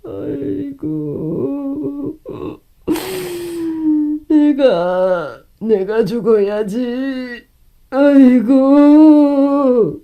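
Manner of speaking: high wailing sighs of despair; a mother wailing in grief; wailing lament. Acted. Mother laments the daughter's betrayal.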